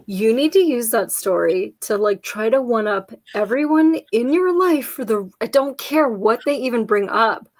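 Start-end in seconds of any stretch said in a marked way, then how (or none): none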